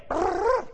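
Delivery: imitated burst of run-together speech; high trilled blur of noise; fast-talker imitation. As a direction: mimic words blurred into one brrr. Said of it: "he speaks so fast".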